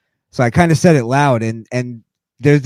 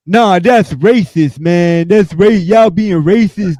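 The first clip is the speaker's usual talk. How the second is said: high, louder, slow offended voice; hammed-up outrage at being profiled; mock indignant customer; 7 semitones higher, 5 dB louder, slower than usual. Customer accusing again, mock indignant.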